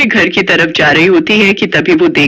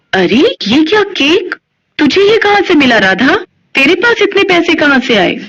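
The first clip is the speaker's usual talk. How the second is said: she can't believe her eyes; astonished mother; 9 semitones higher than usual. Acted. Mother seeing the cake in Radha's hands, high astonished.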